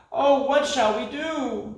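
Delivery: high, slow moan. Acted. Creatures moaning as the moon disappears, high and slow. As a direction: moan in despair. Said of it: "the night creatures moaned".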